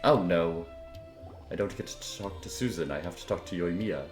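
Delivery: normal talking voice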